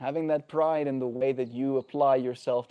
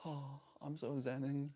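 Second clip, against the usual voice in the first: very soft, sighed-out calm; airy little parody of inner peace; mock zen; 13 dB quieter than usual. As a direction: pretend to be blissfully serene, hushed. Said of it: "extra zen".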